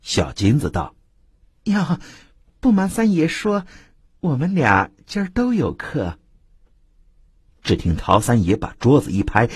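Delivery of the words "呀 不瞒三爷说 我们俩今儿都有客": coy courtesan. Acted. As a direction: sweetly dodge the bully. Courtesan gently refuses patron.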